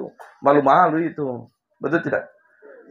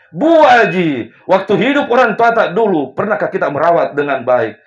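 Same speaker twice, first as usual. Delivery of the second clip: stern rebuke; 6 semitones higher, 7 dB louder than usual. Retold or imitated. Preacher confronts the woman.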